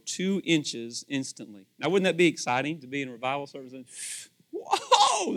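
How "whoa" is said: excited whoa. Pretend. Imagined reaction at a revival.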